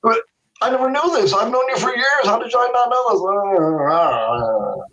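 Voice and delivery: a hint of the friend's puzzled protest; baffled friend. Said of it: "he goes".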